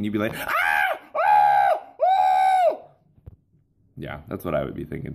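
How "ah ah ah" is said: loud panicked screams, the last one shrill and high; tiny ant shrieking in terror; terrified ant screams. Pretend. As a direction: scream as a giant shoe comes down. Ant about to be stepped on.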